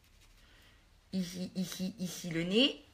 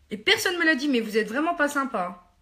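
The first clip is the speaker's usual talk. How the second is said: mock indignant; 5 semitones higher, 4 dB louder than usual. Real, not acted. Complains nobody warned her.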